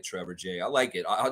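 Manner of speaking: normal talking voice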